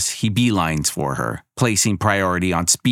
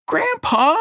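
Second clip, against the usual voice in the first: put-on much higher shocked girl; betrayed gasp; 3 dB louder than usual. A narrator's reading. Girl cries out at her grandfather, shocked and betrayed. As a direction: cry out, hurt and betrayed.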